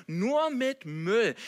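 loud, high, very drawn out; acted exasperation at yourself; exasperated self-reproach. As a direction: scold yourself, stretching each word. Scolds himself in acted self-talk.

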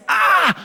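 sharp high yell of pain; a man's sudden pained scream, imitated. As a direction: cry out like a stab of hip pain. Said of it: "schreien muss".

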